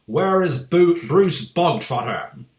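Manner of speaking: loud and slow, calling him out; barked. A narrator's reading. Headmistress demanding the boy in assembly, loud bark. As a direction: boom the question over the hall.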